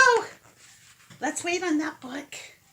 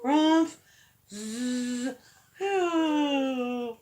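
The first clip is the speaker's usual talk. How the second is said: sleepy dog noises; 3 dB quieter, much slower than usual. Shown as a demonstration. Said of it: "Can you do that one?".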